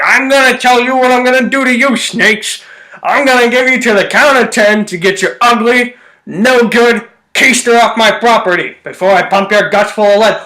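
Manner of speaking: put-on old-timey movie gangster voice. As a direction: growl the threat like an old film tough. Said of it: "old-timey nineteen thirties actor's voice".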